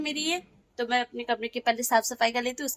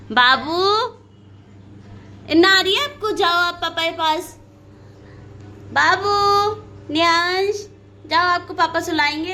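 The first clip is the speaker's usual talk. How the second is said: urging sleepy son; 5 semitones higher, 4 dB louder than usual. Real, not acted. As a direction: coax him off to papa, higher and louder. (नींद में बच्चे को ऊँची आवाज़ में पापा के पास भेजना)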